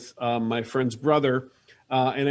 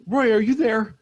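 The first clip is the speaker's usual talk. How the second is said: high-pitched, urgent radio call; Beth calling; 8 semitones higher, 4 dB louder than usual. Acted. Beth checks if Roy hears her, calling out.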